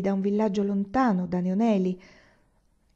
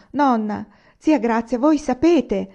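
louder, higher, startled outcry; frightened cry; 4 semitones higher, 6 dB louder, slower than usual. A narrator's reading. Anania realises the old aunt knows, frightened. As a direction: cry out in sudden alarm. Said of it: "gridò con aria spaventata".

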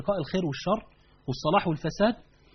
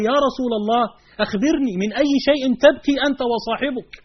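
mock astonished plea, a touch louder; astonished question; 6 semitones higher, 6 dB louder than usual. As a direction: ask in amazement, pleading to know why. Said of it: "مستغربا مستدهشا". (سؤال متعجب مندهش قليلا)

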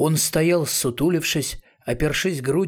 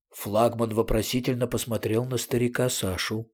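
ordinary delivery, nothing marked

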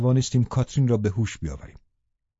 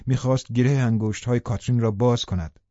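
plain reading voice

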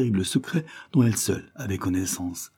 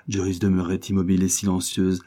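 usual speaking voice

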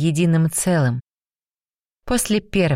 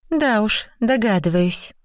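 put-on higher-pitched girl's light grumble; mild grumble; 6 semitones higher than usual. A narrator's reading. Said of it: "проворчала она". (слегка ворчливо, высоким голосом)

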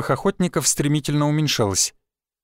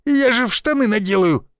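high squeaky panic; 8 semitones higher than usual. Acted. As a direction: squeak it out desperately. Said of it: "пропищал я".

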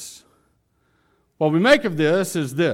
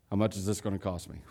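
low, quick, hushed grumble; husband's dry aside; wary husband; 7 semitones lower, 12 dB quieter, much faster than usual. Acted. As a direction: ask it low and soft, suspiciously. Acted husband wary of wife.

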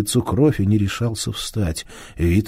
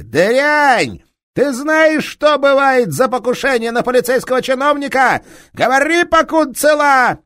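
higher, louder furious bellow; angry detective shouting down a thief; enraged police inspector; 9 dB louder than usual. Acted. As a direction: roar at the thief with fury.

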